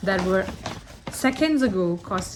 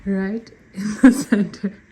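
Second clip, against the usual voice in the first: laughing; 7 dB louder than usual. Real, not acted. Dog sitting on the bed.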